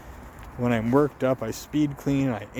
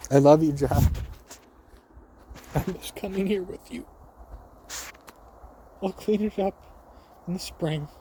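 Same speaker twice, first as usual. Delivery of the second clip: higher, tender words to the dog Jack; 7 semitones higher, 3 dB quieter than usual. Real, not acted. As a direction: speak softly and lovingly to your lost dog. Talking to her late dog.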